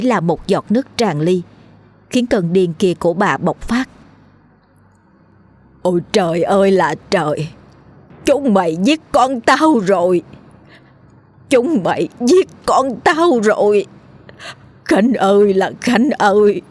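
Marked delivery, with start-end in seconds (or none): 5.8-16.6 crazed mother shrieking over her son; shrieking lament. Acted. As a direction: howl out your grief like a madwoman. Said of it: "Vừa tru tréo".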